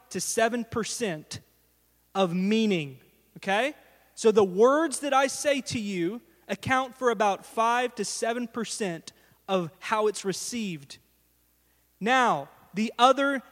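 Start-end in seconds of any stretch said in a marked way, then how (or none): none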